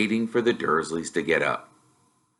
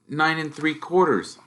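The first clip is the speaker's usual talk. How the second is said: put-on hesitant, higher young voice; nervous boy asking his uncle; young Harry, unsure and polite; timid boy; 7 semitones higher than usual. Harry answers quietly.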